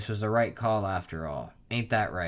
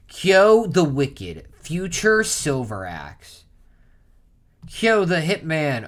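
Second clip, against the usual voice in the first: slow, much higher and louder dramatic title call; epic announcer; 7 semitones higher, 10 dB louder, much slower than usual. Pretend. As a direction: announce the villain's name like a movie trailer. Dramatic reading of character titles.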